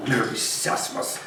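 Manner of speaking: muttered mockingly, under breath; old miser mocking the greeting; scornful mutter. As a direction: sneer the phrase to yourself.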